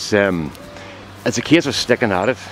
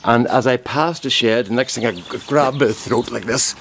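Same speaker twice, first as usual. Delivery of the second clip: normal talking voice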